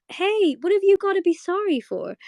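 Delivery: reassuring Marcy voice; Marcy line. Retold or imitated. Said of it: "Marcy goes".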